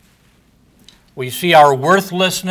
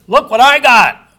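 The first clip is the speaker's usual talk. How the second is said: high, loud gloating over the purchase; boasting buyer; 9 semitones higher, 7 dB louder than usual. Retold or imitated. Buyer boasting to friends after the purchase, gleeful crowing. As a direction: crow about the bargain. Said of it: "then he boasteth".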